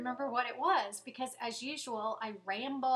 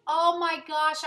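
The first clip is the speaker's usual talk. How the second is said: drawn-out, loud, high oh my gosh; excited gasp; 6 semitones higher, 11 dB louder, slower than usual. Real, not acted. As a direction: burst out as it suddenly comes to mind. Suddenly remembers news to share.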